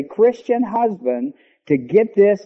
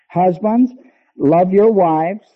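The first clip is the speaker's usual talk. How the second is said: slow, louder ringing proclamation of "love your wives"; preaching proclamation; 3 dB louder, slower than usual. Acted. Preacher proclaiming the command to husbands, slow and loud. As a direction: call it out like scripture.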